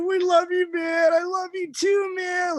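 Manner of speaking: loud, choked-up shout of love; emotional shout. Retold or imitated. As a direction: shout it out while hugging. Emotional summit hug.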